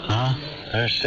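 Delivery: ordinary talk, nothing marked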